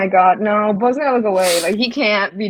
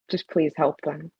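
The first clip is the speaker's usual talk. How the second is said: quiet plea, lower and softer; softer, less commanding; 4 semitones lower, 9 dB quieter than usual. Acted. Bo softly begging the major to help them. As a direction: drop the Major tone, just ask gently. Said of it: "step down a little from, like, Major tone".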